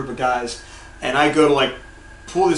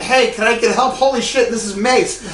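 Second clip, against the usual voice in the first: higher, startled mock panic; alarmed retold outburst; 7 semitones higher than usual. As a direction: reenact sudden alarm at the mace. Retells alarm at facing mace.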